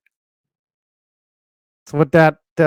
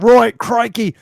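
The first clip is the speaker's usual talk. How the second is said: hammed-up Aussie bloke spoiling for a fight, pitched higher; crikey mate, play the brawling Aussie; cartoon Australian yob egging on a fight; mock Australian; 5 semitones higher than usual. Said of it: "they're just going after the fight like".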